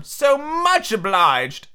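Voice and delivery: high, very drawn-out thanks; slow, high storybook-twin voice; effusive Tweedledee. Acted.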